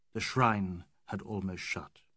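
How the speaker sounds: ordinary delivery, nothing marked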